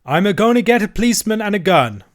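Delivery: high, loud rustic holler; shouted warning. A narrator's reading. Andrew warning the boys in the tower, shouting. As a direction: call the threat upward. Servant calls threat up to tower.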